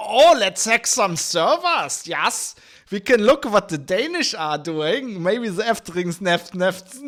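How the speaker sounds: slow, loud mock German accent. German hackers planning to snoop on the Danish, in a slow mock German accent. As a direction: drag it out in a hammed-up German accent. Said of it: "And then the Germans go".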